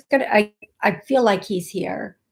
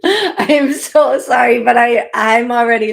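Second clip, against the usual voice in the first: higher, louder, laughing while welling up; laughing through tears; 3 semitones higher, 8 dB louder than usual. Real, not acted. Medium fighting tears during the reading, laughing through tears. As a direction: apologize as emotion breaks through. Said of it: "the tears are already forming".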